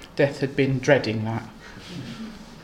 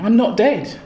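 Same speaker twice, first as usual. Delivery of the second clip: higher, louder, overjoyed; delighted; 6 semitones higher, 4 dB louder, slower than usual. Acted. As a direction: burst out with relief. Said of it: "utterly delighted".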